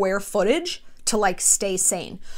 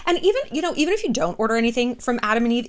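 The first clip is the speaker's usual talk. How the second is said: ordinary delivery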